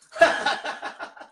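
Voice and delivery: a voiceless wheezing laugh; real amused laugh; breathy laugh. Real, not acted. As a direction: let out a silent chuckle. Still laughing at the voice crack.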